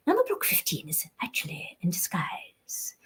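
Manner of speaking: whispery undercover spy voice; Number Block fifteen. Shown as a demonstration. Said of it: "she's a bit of a spy".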